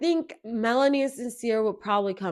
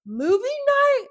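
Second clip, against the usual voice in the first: much higher and louder, slowed; bursting with shock at the memory; excited; 14 semitones higher, 6 dB louder, slower than usual. Real, not acted. Telling viewers about movie night, excited and high.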